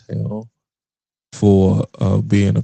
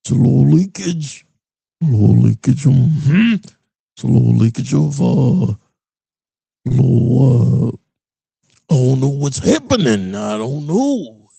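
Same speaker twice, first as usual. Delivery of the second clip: gruff, hammed-up wrestler promo voice; Macho Man Randy Savage. Comedian doing a wrestler impression to mock politics. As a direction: growl it like a wrestling interview. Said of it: "I'm doing my Macho Man Randy Savage impression".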